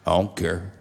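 lightly voiced dying man, pitched low; a hint of a stubborn sick man, low; ailing old friend. Dying man brushes off his friend's objection, lightly voiced low. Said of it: "He's a dying man".

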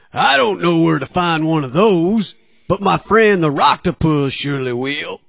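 upbeat cartoon fish character; cheerful, hurried sea pal; friendly sea creature voice.

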